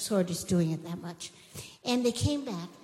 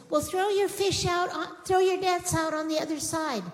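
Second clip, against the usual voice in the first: high, raised calling voice; 10 semitones higher, 4 dB louder than usual. Retold or imitated. Man on shore tells fishermen where to cast, calling out. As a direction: call instructions out to a distant boat.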